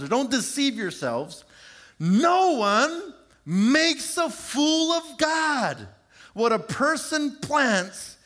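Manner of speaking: high, slow, emphatic scripture reading; scripture quote. Acted. Preacher reading Galatians to the congregation, emphatic and slow. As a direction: proclaim the verse with weight. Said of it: "what Galatians chapter six, verse seven says".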